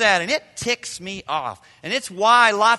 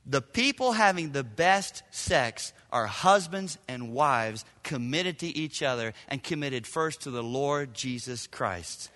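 usual speaking voice

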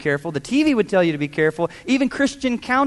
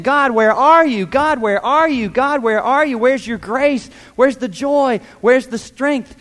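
desperate cry; 6 semitones higher, 5 dB louder than usual. Retold or imitated. Voicing desperate believers crying out.

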